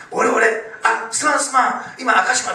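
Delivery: loud, high, hurried-in mock phone call; excited apologetic call. Rescued husband phoning his wife, excited loud apology. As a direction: blurt it loudly, imitating a sheepish survivor.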